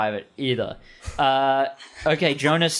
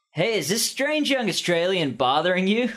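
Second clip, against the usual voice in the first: put-on teenage hero voice; 6 semitones higher than usual.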